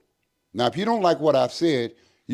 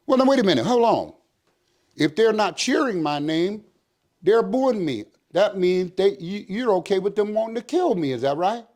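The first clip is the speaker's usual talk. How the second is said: heated confrontation; 7 semitones higher than usual. Retold or imitated. Captain confronting officers in his office, heated. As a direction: push back hard on them.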